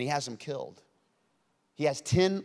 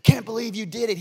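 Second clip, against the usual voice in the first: mock angry yell; 8 semitones higher, 6 dB louder than usual. God storming the garden at the couple, mock yelling. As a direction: play the furious God he is not. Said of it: "traipse through the garden all mad, yelling".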